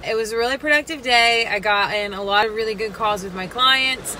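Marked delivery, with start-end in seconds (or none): none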